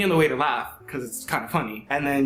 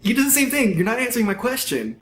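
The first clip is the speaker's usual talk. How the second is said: high, fast, laughing gotcha; excited outburst; 10 semitones higher than usual. Retold or imitated. To his date, catching her dodge: excited laughing outburst.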